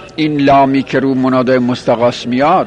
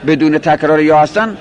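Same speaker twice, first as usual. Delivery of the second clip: ordinary talk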